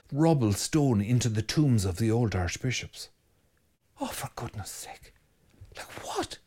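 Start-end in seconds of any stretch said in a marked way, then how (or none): 4.0-6.4 whispered disbelief; exasperated whisper. Acted. Priest whispering his dismay at the bishop's wreckage. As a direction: sigh it out under your breath, aghast.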